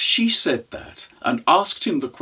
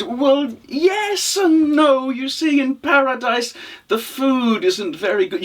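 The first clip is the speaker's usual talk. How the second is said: high, hedging character voice; wheedling traveller; 8 semitones higher than usual. Acted. Hungry traveller angling for the widow's stew, wheedling. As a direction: stretch the hedge, then hint for food.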